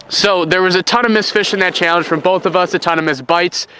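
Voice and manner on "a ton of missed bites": usual speaking voice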